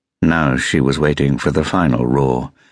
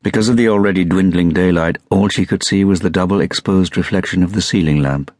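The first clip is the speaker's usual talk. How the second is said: ordinary delivery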